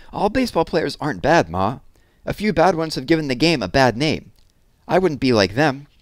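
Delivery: eager lad pleading his case; earnest boy. A narrator's reading. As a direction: defend your dream to your ma. Boy defending baseball.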